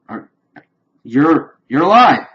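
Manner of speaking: high, loud disbelieving cry of relief; shocked joy. Retold or imitated. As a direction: blurt it out as if seeing a ghost. Reunited with friend thought dead.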